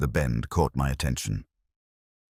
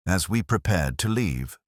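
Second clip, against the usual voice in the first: ordinary delivery, nothing marked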